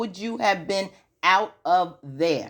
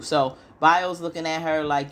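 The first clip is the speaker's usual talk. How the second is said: ordinary talk, nothing marked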